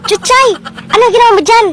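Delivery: high, loud call across. Acted. Friend spotting Chuchay from afar, loud high call. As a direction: shout her name up high.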